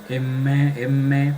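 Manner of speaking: M held long with a humming nasal middle; resonant M. Shown as a demonstration. Teacher showing where the voice resonates, M drawn out and hummed. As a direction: hum through each M to feel the sinus vibrate. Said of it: "Siento acá una resonancia yo a nivel acá".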